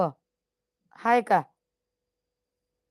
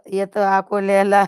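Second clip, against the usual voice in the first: ordinary talk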